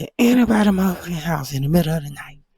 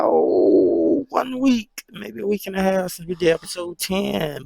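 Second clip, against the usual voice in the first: normal talking voice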